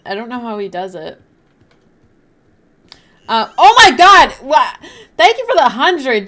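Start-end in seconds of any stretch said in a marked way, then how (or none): 3.3-6.2 high, loud shocked thanks; loud surprised gratitude; excited surprise. Real, not acted. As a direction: blurt it out, just noticed. Noticing a hundred donation late.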